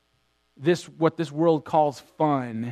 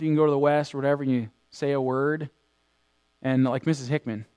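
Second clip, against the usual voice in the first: ordinary delivery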